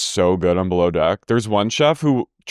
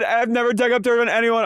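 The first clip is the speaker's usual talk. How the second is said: much higher outraged imitation; mock Lisa; 12 semitones higher than usual. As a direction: shriek it like an offended Lisa. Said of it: "and Lisa goes".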